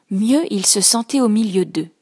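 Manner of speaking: ordinary delivery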